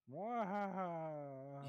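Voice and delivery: slow hammed-up evil laugh; sneering panto villain. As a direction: cackle like a cartoon villain. Villain laugh after sending rabbit.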